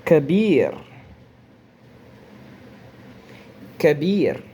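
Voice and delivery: Kabir with a short fatha a and a final r; Arabic word Kabir. Shown as a demonstration. Teacher models Arabic Kabir for students, short vowel. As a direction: model Kabir clearly and firmly. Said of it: "o A que eu tô pronunciando é o do Fatha".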